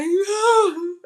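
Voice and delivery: high, loud fake sob; mock wailing sob. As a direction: ham up a crying fit. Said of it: "I'm like".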